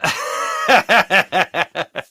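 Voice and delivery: big high burst of laughter; loud laugh. Real, not acted. Reacting to praise.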